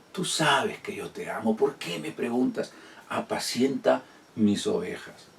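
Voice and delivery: soft, tender Jesus-and-Peter exchange; hushed retelling. Acted. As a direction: drop to an intimate hush.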